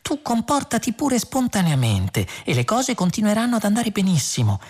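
high, singsong false reassurance; overdone lilting cheer; trilling. A narrator's reading. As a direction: warble it sweetly and high. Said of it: "gorgheggiò ancora Trillian".

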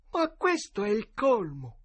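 high, slow, baffled exclamation; perplexed. A narrator's reading. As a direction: scratch your head in loud puzzlement. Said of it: "con aria perplessa e disse". (esclamazione perplessa)

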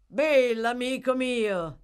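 high, loud, stretched-out hail; jovial, booming welcome; hearty greeting. A narrator's reading. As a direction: greet an old friend expansively. Toby hails his arriving friend.

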